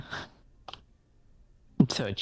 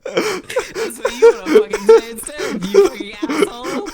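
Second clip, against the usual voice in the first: higher, much louder laughing rant; laughing mock yell; 10 semitones higher, 9 dB louder than usual. Wife yelling at her husband in mock fury.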